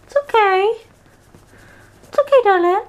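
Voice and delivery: high cooing pet voice; high sympathetic coo. Real, not acted. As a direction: coo at a frightened puppy. Soothing scared dog.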